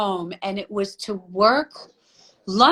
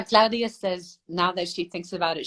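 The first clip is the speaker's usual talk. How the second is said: ordinary talk, nothing marked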